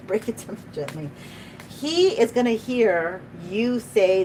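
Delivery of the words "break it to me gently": softly repeated. Shown as a demonstration. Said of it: "is what I just heard".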